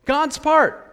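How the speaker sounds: high, loud, punchy answer; God's part rung out high and loud; emphatic answer. Real, not acted. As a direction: hit the answer hard. Answering his own question.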